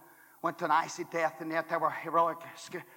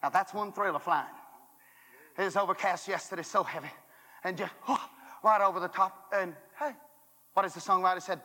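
usual speaking voice

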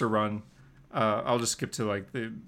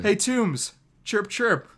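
higher teasing chirp; mocking taunt; 6 semitones higher, 4 dB louder, much slower than usual. Peter teasing Toombs from a stolen glider. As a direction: taunt him like a bird.

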